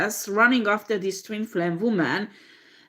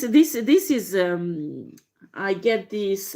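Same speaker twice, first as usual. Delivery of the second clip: usual speaking voice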